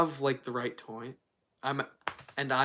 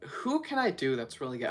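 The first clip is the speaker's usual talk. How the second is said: ordinary talk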